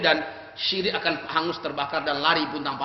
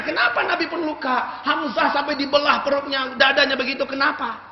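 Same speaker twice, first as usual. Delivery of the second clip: heated rhetorical questions; 7 semitones higher, 4 dB louder than usual. Retold or imitated.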